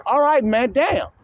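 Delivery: mimicking exasperated viewers. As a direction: play the fed-up fans begging him to stop. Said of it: "They're telling Ringo and shit right on his live stream".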